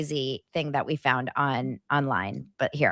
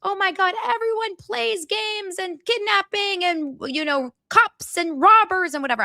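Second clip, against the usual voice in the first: mocking voice; 14 semitones higher than usual. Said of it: "because they said".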